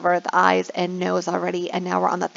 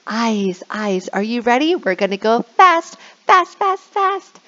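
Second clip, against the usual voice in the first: excited; 4 semitones higher than usual. Shown as a demonstration. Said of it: "and I'll say".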